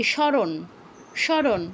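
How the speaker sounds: স্মরণ said as shoron, the ম silent; sharon sound. Shown as a demonstration. Teacher asking students how স্মরণ is said. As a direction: say স্মরণ without the ম. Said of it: "বাংলায় এটার উচ্চারণ কি".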